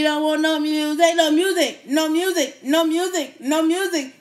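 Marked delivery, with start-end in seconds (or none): none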